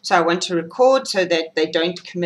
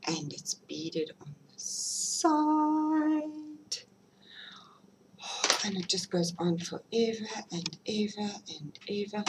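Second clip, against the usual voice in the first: awed whisper; 3 semitones higher, 9 dB quieter than usual. Real, not acted. Vlogger whispering to viewers over the shawl beads, awed. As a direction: breathe it out in awe over the beads.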